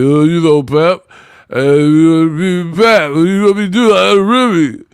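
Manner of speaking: garbled, strained mock voice in the style of Bobcat Goldthwait; Clarissa impression. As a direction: stammer incoherently, mocking how she talks. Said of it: "she sound like Bobcat Goldthwait".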